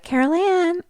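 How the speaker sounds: high sing-song call of the name; mother calling. Retold or imitated. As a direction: call out gently to a hidden child. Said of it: "the mom is like".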